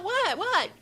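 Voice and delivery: high, loud, incredulous what; stunned disbelief. Retold or imitated. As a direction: squeal it out in disbelief. Retold shocked reaction to the news.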